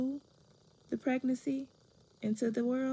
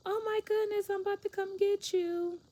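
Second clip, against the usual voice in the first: gasp, then gush in a high voice; mom's gushing reaction; 12 semitones higher than usual. Retold or imitated. Mom hearing her daughter started her period, high mock gushing.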